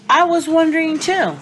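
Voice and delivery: put-on high, loud excited kid; excited child. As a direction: chirp it out eagerly. Excited aside.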